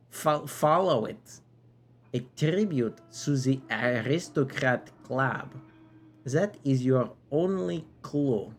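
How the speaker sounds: theatrical knight with an exaggerated French accent; silly French accent. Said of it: "a very silly French accent".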